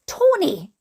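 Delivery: high, sing-out name call; declaring. A narrator's reading. Grace calling to Tony in the corridor, declaring. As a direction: call his name out brightly, pitched well up. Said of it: "declared Grace".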